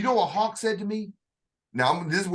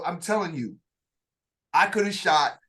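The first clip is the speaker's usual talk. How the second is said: ordinary talk, nothing marked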